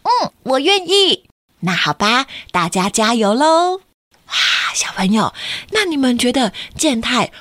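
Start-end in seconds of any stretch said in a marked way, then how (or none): 0.1-1.2 put-on high, slow shy boy voice; Kenta voice. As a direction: answer like a hesitant little boy. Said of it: "老师也问健太说".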